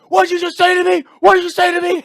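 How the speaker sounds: very high, loud imitated enraged scream; hammed-up screeching fury; losing it. Guy being thrown out of the bar, losing it. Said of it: "He loses it".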